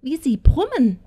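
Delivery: put-on high, gleeful old-witch exclamation; excited call. A narrator's reading. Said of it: "rief sie dann".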